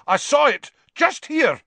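very high, loud outburst; furious. Acted. Executioner insisting he saw the beast, furious shout. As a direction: bellow it, enraged and insistent. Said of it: "said the executioner furiously".

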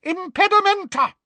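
very high, forceful yell; yelled spell. A narrator's reading. Someone casts a spell to stop Harry, high yell. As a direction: shout the spell to break up the fight. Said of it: "somebody in the vicinity yelled".

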